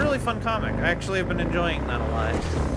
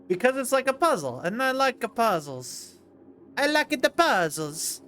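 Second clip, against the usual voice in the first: higher-pitched hammed-up Italian accent; bad Italian accent; 6 semitones higher than usual. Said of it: "terrible impression of an Italian accent".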